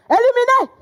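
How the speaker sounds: high loud éliminés; triumphant elimination call; loud verdict. Acted. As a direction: declare them out, shouting. Verdict announced.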